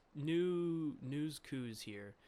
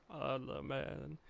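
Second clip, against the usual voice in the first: normal talking voice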